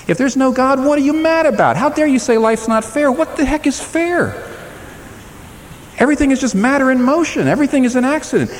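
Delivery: high, urgent preaching challenge; preacher demanding answers, rising high; fiery preaching. Acted. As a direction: press the point hard from the pulpit.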